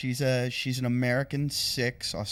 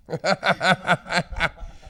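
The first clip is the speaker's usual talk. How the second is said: burst of laughter; 5 semitones higher, 5 dB louder than usual. Real, not acted. Punchline "He's dead" lands.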